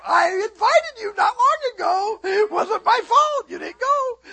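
very high, loud comic protest; hammed-up wail of self-defence, drawn out at the end; mock pleading defence. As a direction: protest your innocence in a squealing voice. Mock defence against daughter's complaint.

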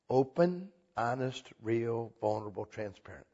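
a quiet, dropping list; soft fading list. Acted. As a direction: let each quality fall away into a hush. Listing qualities softly, fading.

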